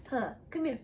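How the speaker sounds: high soft coo to cat; sweet talk to the cat; cat mom voice. Real, not acted. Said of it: "my cat mom voice".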